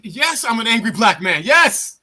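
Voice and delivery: Yes said high and loud; defiant admission. Retold or imitated. Angry soldier answering the officer, loud and defiant. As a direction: own it with a rising loud yes. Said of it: "You t".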